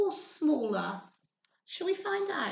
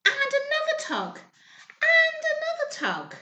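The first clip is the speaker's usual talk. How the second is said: rising effort; 13 semitones higher, 3 dB louder than usual. A narrator's reading. Tugging harder on the thread.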